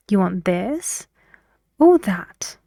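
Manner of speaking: "this" rises, "that" falls; either-or question, "this" up and "that" down; rising then falling intonation. Shown as a demonstration. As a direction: lift "this", pause, then come down on "that". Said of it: "the rising and falling on the words".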